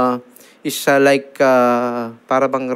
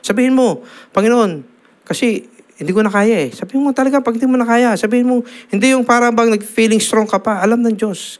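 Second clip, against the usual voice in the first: higher, fast, impassioned urging; fervent preaching; 9 semitones higher than usual. Acted. Preacher urges the congregation to confess weakness to God, impassioned. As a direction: press the flock to be honest with God.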